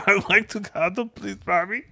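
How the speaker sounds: put-on high pleading child voice; baby talk. Parent imitating son asking mommy to cuddle, high pleading kid voice. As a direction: beg mommy like a little kid.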